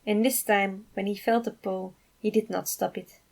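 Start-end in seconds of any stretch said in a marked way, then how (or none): none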